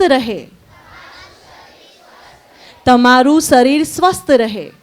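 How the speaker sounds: slow, measured lead-in for group repetition; call-and-repeat blessing. Acted. Teacher leading children in blessings to repeat, slow measured recitation. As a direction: recite each blessing for the class to echo.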